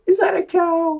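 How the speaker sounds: high, bright, surprised ask; mock surprise. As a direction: play shocked for the kids.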